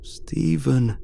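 wailing. A narrator's reading. Dying colleague calls to the narrator through a speaker, wailing. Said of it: "he wailed".

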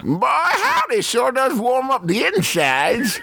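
put-on high, slow old-timer drawl; old prospector voice. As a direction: savor it like an old miner. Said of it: "Use your old prospector voice".

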